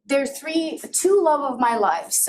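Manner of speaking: ordinary talk, nothing marked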